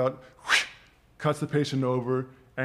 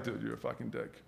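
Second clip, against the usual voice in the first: quiet anger; 3 semitones lower, 12 dB quieter than usual. Retold or imitated. Confronting the guy who set him up.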